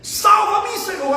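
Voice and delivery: high, loud cry for rescue; wołanie o pomoc. Acted. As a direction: cry out to the Lord as if sinking. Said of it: "Aí ele gritou e falou assim".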